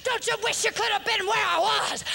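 impassioned preacher near tears; preaching at full force. Acted. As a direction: pour it out like a revival sermon.

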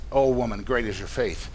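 low, soft, tender pronouncement; sincere. Acted. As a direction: turn to her and grant it gently. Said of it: "straight-up sincere conversation".

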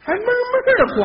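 high-pitched scoffing echo; mocking disbelief. Straight man mocks partner's crying-audience claim, high mocking disbelief. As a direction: throw it back, high and incredulous.

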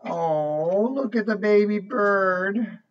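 plain reading voice

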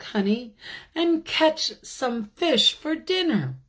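high, fussing wife's warning. Acted. The bear's wife warning him about the beehive, high and fussing. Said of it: "she said to him one day".

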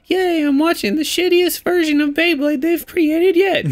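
very high, squeaky fake cheer; mock excitement. Viewer at a cartoon screening, mock-excited squeal.

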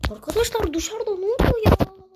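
high, loud shout about the noise; loud exasperated call. Real, not acted. Streamer asking viewers about the noise outside, high and loud. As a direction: yell it out in disbelief.

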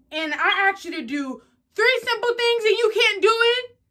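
loud, high-pitched angry rant; scolding parent. Acted. Acted parent scolding over chores.

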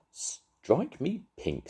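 high, very slow astonished drawl; fisherman's surprise. A narrator's reading. Fisherman gaping at a bicycle, slow astonished drawl. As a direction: stretch it out, amazed.

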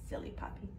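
soft unvoiced whisper; hushed teasing aside. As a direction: whisper it fondly to the kids. Aside after rhyme verse.

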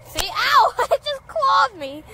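high startled yelp, laughing; surprised ow. Real, not acted. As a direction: yelp as the claws dig in. Guinea clawing her hand.

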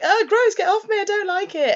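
very high, squealed mock disgust; disgusted protest. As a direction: act a grossed-out boy fending someone off.

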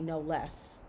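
ordinary delivery, nothing marked

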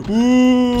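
long spooky ghost wail; ghostly moan. Acted. Husband pranking wife, drawn-out ghost moan. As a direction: moan like a haunting ghost to scare her. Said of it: "Gökçe'yi korkutacağım".

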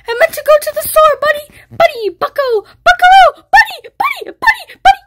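high, fast frantic begging; panicked high pleading. Real, not acted. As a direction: beg him frantically to stop.

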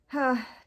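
loud frustrated sigh; exasperated sigh. Acted. Anansi fed up after the failed trap, exasperated sigh. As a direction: let out a big fed-up sigh.

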